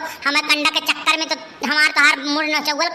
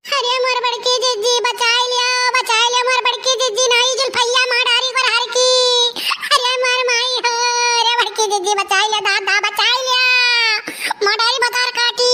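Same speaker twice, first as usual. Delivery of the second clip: high, wailing plea; mock wailing for help; crying for help; 7 semitones higher than usual. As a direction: wail for rescue while being beaten. Being beaten, crying for help.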